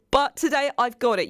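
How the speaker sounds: normal talking voice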